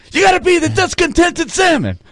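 high-pitched mock motto; mock coach voice. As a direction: squeak it out like a slogan. Team quoting motto, high mock.

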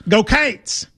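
"Cates" said as KAYTS, Kentucky drawl; "Cates" with a long ay vowel, like a Kentucky fan. Shown as a demonstration. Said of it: "in Kentucky would go".

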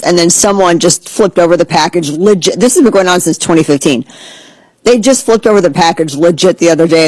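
ordinary delivery

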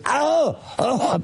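high mock squeal of surprise; playful squeal. Imitating Deborah's playful high squeal while biting. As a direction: squeal it up high like a teasing bite.

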